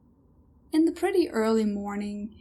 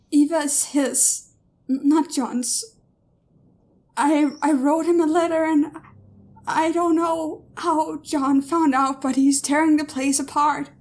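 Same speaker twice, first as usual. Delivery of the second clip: frightened; 5 semitones higher, 3 dB louder than usual. Acted. Daughter's frightened confession.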